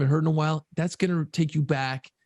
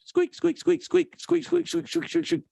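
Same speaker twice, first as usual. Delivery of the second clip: much higher squeaky imitation of a marker; marker squeak; 14 semitones higher than usual. Dad imitating his son's marker squeaking. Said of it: "that squeak sound that markers make".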